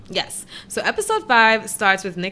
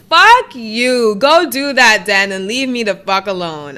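angry rant; 8 dB louder than usual. Real, not acted. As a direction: yell it in real indignation. Ranting at Jared.

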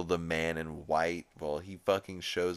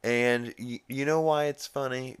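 usual speaking voice

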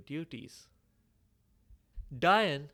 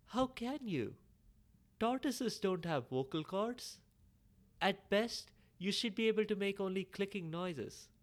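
high, wide-eyed disbelief; astonished child; 6 semitones higher than usual. Acted. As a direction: squeak it out in amazement. Child astonished at talking tortoise.

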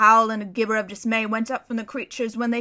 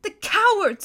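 high, louder sobbing cry; sobbing outcry; 10 semitones higher, 6 dB louder than usual. Acted. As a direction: break out in grief and anger. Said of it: "sobbed Susan".

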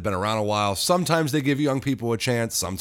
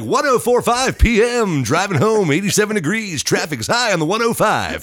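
radio announcer voice; 9 semitones higher, 6 dB louder than usual. Shown as a demonstration. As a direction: read the traffic report on air. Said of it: "I could do a radio".

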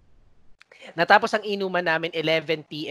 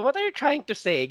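higher-pitched puzzled caller; mock bewilderment, pitch raised; retold baffled customer; 7 semitones higher than usual. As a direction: lightly imitate a confused customer. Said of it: "naririnig ko yung customer na".

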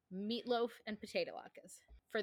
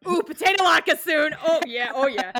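higher, louder gleeful ooh; excited latke joy; 5 semitones higher, 10 dB louder than usual. Retold or imitated. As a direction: savour the thought out loud. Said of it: "I'm like".

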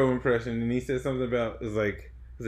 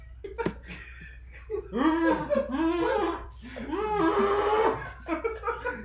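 long, high squealing laugh; drawn-out, high-pitched cackling; helpless laughing fit; 6 dB quieter than usual. Real, not acted. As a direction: crack up completely and keep going. Cracks up at own card.

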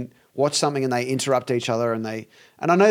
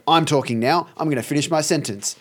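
normal talking voice

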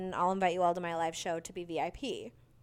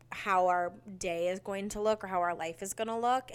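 ordinary talk

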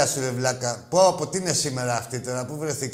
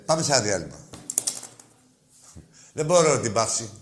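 normal talking voice

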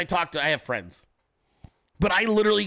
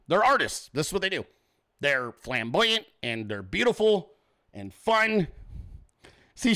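ordinary delivery

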